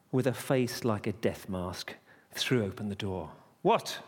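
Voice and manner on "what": curt, higher-pitched bark. Acted. Innkeeper throwing open the door, barking irritably. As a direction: bark it out as one irritated word. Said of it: "He, he barked".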